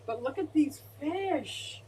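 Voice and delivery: ordinary delivery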